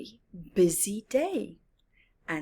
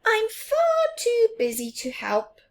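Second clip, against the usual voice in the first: much higher squeaky refusal; high cry; 5 dB louder than usual. Acted. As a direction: cry out and run off. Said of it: "cried Little Mouse".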